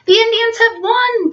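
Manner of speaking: high, bright cry of triumph; boy shouting good news; excited shout. A narrator's reading. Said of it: "Peter shouted".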